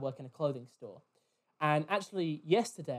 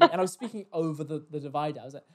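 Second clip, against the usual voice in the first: ordinary talk, nothing marked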